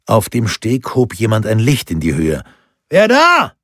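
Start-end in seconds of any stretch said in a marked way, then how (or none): 2.9-3.5 high, loud shout; shouted challenge. A narrator's reading. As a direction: call out sharply like a sentry. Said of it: "schrie er".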